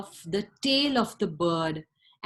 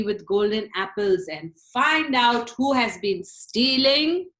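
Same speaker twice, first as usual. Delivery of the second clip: commanding Tsar; 7 dB louder than usual. Acted. Tsar orders eldest son.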